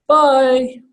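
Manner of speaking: high, drawn-out sweet bye; girly goodbye. Shown as a demonstration. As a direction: sing out a friendly high bye. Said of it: "I'll do things like".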